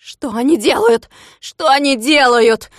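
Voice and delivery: high, loud, slow dismayed wail; wailing howl. A narrator's reading. Klops seeing his strawberries trampled, wailing. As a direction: wail in horror at the ruined berries. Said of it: "завопил Клопс".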